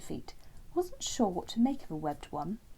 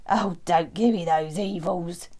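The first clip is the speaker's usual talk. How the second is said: slow, loud frog voice, teasing; cheeky frog; 2 semitones lower, 9 dB louder than usual. Acted. Frog answering Terence's glare, loud cheeky frog voice. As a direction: brush off his look cheekily.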